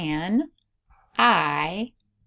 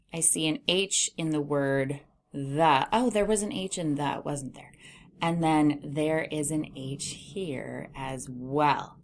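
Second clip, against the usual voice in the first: ordinary delivery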